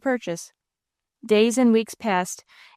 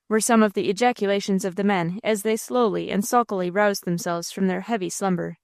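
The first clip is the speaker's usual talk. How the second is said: plain reading voice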